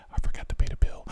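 hushed confession under the breath; sheepish whispered aside. Real, not acted.

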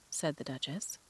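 plain reading voice

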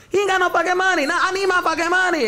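very high-pitched mock female complaint; complaining dancer. Dancer telling security he won't pay, high mock outrage.

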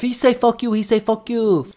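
high mock-yelled broken English; imitating his yelling. Retelling to the co-host, mock yelling in broken English. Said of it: "that's what he was saying".

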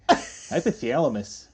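slow, drawn-out Minnesota vowels; mock Minnesota accent. Shown as a demonstration. Co-host showing the Minnesota thing, mock accent. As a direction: stretch it like a Minnesotan. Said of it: "It's like a Minnesota thing".

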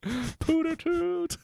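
very high, very slow, drawn-out squeaky voice; silly cartoon voice. Host echoing the show's joke name in a silly high voice. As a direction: stretch it out like a goofy cartoon character.

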